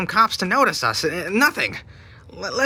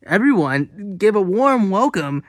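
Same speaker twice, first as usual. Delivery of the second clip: loud, slow, grand welcome; showman announcement; 6 semitones higher, 6 dB louder, slower than usual. Acted. Host introduces the Squids to the audience, loud and grand. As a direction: sell it like a game show host.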